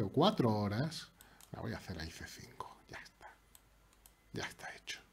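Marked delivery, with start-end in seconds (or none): 1.5-5.0 whispering the move to himself; breathy secret whisper of the plan; hushed unvoiced murmur. Real, not acted. Whispering his chess move.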